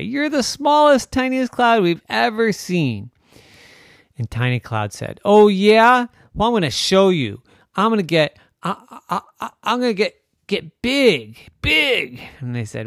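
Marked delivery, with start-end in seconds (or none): 0.0-3.1 high, loud mocking taunt; taunting clouds. A narrator's reading. Other clouds taunt Tiny Cloud. 5.2-12.2 high, loud stammering boast; defiant little cloud. A narrator's reading.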